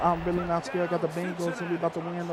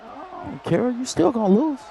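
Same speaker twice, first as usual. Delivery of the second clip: high taunt; 8 semitones higher, 5 dB louder than usual. Acted. Taunting after timeout.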